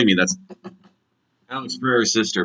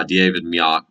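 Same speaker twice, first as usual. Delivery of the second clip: normal talking voice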